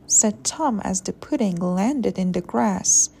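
ordinary delivery

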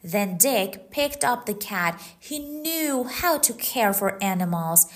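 usual speaking voice

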